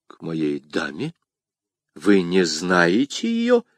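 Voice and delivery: low, slow, surprised questions; с удивлением. A narrator's reading. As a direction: draw out the puzzled questions in a low voice. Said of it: "с удивлением сказал незнакомец". (медленно, низко, с удивлением)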